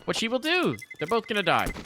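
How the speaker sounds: high, loud commentator's cry; excited climax call. Real, not acted. Caster calls the last deaths of the round, excited high shout. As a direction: shout the round's final twist.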